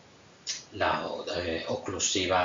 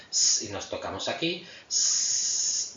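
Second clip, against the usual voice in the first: a held, breathless hiss; voiceless s hiss. Shown as a demonstration. Teacher demos a voiceless s with no voice behind it. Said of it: "decimos la S sorda".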